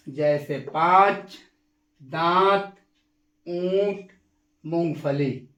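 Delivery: nasal vowels drawn out clearly. Shown as a demonstration. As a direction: let the vowel ring through the nose in पाँच, दांत, ऊंट, मूंगफली. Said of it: "एक बार और".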